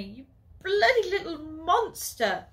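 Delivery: loud high angry yell; furious insult. Acted. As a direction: hurl the insult at him. Furious sister throws guts.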